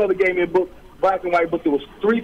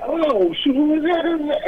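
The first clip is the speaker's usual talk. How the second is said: much higher, eager "who is that?"; a younger self suddenly hooked; excited surprise; 9 semitones higher than usual. Retold or imitated. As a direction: perk up at the sound of the rhymes. Excited on hearing the book quoted.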